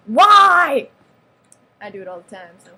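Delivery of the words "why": high, very loud wail of a question; over-the-top cry; dramatic yell. Pretend. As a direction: yell it out in mock outrage. Dramatic question about ugly people.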